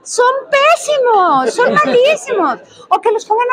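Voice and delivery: high, very slow, drawn-out scorn; slow high mock horror; exaggerated disdain. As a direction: stretch each word with theatrical disgust. Her blunt verdict to daughter.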